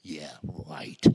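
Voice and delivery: low, dry scoff; mock it lightly, a disbelieving scoff; sarcastic yeah right. Preacher admits doubting Jesus, dry sarcastic scoff. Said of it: "I would have at least said in my heart".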